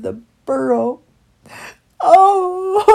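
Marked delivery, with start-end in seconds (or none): 2.0-2.9 loud, high sobbing wail; hammed-up bawling; wailing cry. A narrator's reading. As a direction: wail "oh" into long sobs. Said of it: "cried Christopher".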